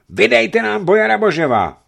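very high, loud yell; cry from the crowd. A narrator's reading. Someone in the mob demands the boyar, loud yell. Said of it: "vykřikl kdosi v davu".

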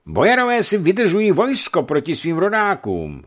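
high, loud accusing cry; angry shout. A narrator's reading. Afanasij Sirkov accuses the boyars before the crowd, angry shout. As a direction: hurl it at the boyars over the crowd.

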